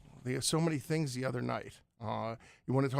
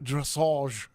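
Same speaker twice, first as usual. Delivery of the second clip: dressage in a mock-British accent, louder; imitated British pronunciation; 4 dB louder than usual. Host mimicking a British colleague at horse jumping, imitated British accent. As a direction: say dressage like a sophisticated Brit. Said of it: "you pronounced the word Renaissance so beautifully".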